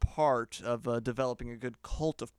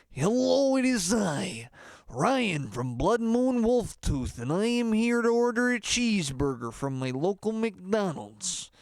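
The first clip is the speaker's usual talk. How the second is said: rock star persona; 6 semitones higher, slower than usual. Shown as a demonstration. Singer ordering a cheeseburger at McDonald's, hammy rock-star proclamation. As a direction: proclaim the order like a show opener. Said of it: "can't go order a cheeseburger in your normal voice".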